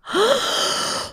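big re-enacted gasp of shock. Re-enacts shock at seeing ring. (chockad, överdriven flämtning)

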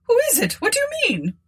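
high-pitched anxious questioning; alarmed old woman. A narrator's reading. Old woman questions terrified Tabitha, alarmed. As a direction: demand answers in a high, frightened voice.

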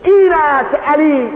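high, loud and slow proclamation; loud slow cry of this is Ali; loud preaching cry. Acted. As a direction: proclaim it to the crowd. Preacher's climax.

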